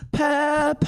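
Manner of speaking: high, far-off crooned word. Pretend. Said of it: "this is [name] from Radiohead".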